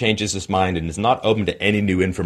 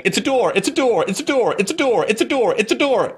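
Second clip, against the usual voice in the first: high, silly repeated chant; dopey insistence; 13 semitones higher than usual. Retold or imitated. Mocking critics as fools walking into walls, high silly chant. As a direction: play the fool who insists the wall is a door. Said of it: "someone who just keeps walking into a wall saying".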